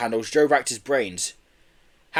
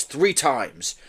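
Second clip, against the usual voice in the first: ordinary delivery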